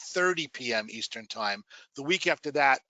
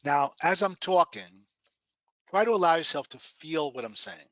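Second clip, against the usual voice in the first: guided meditation. Acted. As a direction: guide them gently into relaxation.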